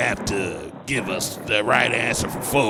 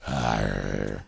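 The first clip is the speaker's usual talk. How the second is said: pirate voice; 12 semitones lower than usual. Pretend. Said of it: "sound like a pilot and pirate".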